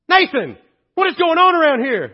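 a mother scolding her son; mom yelling. Retold or imitated. Mom yells after friends leave.

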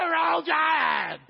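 loud high stadium whoop; fan shout. Retold or imitated. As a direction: cheer like a painted-chest fan on camera. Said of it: "you're going".

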